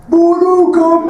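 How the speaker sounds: high, loud, drawn-out shout; shouted announcement. Real, not acted. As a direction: yell it like an arcade announcer. Announcing his wake-up song.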